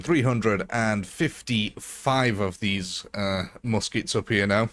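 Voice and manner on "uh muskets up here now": ordinary talk, nothing marked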